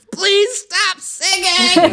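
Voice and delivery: high, loud, slow shout from a doorway; annoyed shopkeeper yell. Acted. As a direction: shopkeeper yelling at the singer to stop. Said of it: "the owner lean goes, goes".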